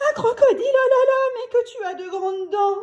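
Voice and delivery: high, loud panicked cry; a scared girl shrieking; frightened cry. A narrator's reading. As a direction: cry out at the big teeth. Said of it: "crie M Toto".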